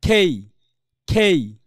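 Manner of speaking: "খেই" drawn out with aspirated K; letter-name demo. Shown as a demonstration. Teacher repeats the remembered letter name, pronunciation demo. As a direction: glide slowly through "খেই". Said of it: "খেই, মনে পড়ছে".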